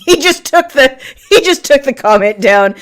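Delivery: laughing as you report it. Real, not acted. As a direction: say it through a laugh. Laughs while reporting a deleted comment.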